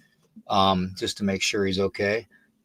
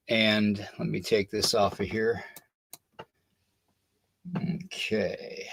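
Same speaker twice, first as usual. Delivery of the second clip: normal talking voice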